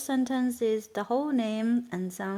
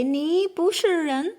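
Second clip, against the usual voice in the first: higher, louder mock-angry "你不是人"; mad at someone; 7 semitones higher, 4 dB louder than usual. Shown as a demonstration. Teacher acting out insulting someone, mock angry. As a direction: snap "你不是人" at someone who made you mad. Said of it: "once you really mad of someone".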